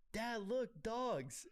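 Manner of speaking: put-on retelling a TikTok, a child's high voice to his dad; kid voice. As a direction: squeak it like an excited child. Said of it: "the kid goes".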